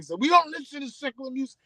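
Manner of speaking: high, prim voice of a disapproving churchgoer; hammed-up pious objection; mock churchgoer. Listeners who shun secular music, in a mock prim high voice. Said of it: "I know my saying".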